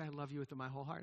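soft, quick, heartfelt declaration; tender vow to wife. Retold or imitated. A husband tells his wife softly how deeply he loves her. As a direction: murmur it into her eyes. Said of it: "I'll say to my wife".

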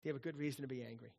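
God gently pressing Jonah; hushed quick question. Acted. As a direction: ask it low and pointed. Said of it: "He said".

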